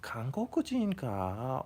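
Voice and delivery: slow, hammed-up Japanese accent; Japanese impersonation. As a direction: ask it as an exaggerated Japanese speaker. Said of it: "I shouldn't make Japanese impersonations".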